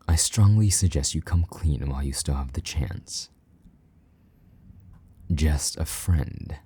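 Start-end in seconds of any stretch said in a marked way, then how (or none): 5.3-6.5 slow mocking echo. Mocking repeat of her excuse.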